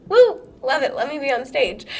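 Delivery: high, loud playful whoop; show-off stage kid. Acted. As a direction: act out a kid craving the spotlight. Acting herself as stage-loving child.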